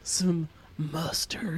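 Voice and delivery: slow breathy whisper; sultry whisper. Pretend. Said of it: "hushed, sultry voices".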